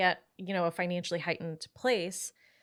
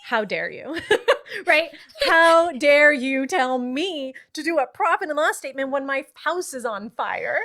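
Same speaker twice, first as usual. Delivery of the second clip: louder, hammed-up indignant client; how dare you, with mock fury; mock outrage; 5 semitones higher, 8 dB louder than usual. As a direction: play the offended client, half joking. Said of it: "And they're like".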